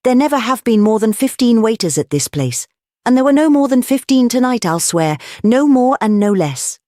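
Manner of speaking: high-pitched, emphatic insistence; excited. A narrator's reading. Young duke swears to the number of waiters, excited. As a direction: swear to it eagerly. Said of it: "cried the duke excitedly".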